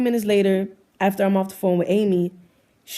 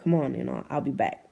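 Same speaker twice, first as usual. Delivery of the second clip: low and soft, brushing it off; soft dodging reply; 7 semitones lower, 7 dB quieter than usual. Retold or imitated. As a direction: play it down, voice dropped and lowered. Girlfriend laughing off her departure.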